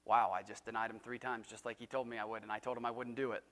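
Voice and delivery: low, soft, stunned realization; hushed regretful admission; quiet dismay. Retold or imitated. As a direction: let it sink in quietly. Peter's realization after denial.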